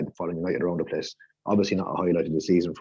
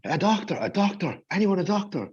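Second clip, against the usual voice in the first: much higher, urgent mock cry; retold scream; 11 semitones higher than usual. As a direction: mimic someone calling out frantically, without real volume. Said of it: "runs out of the internal room screaming".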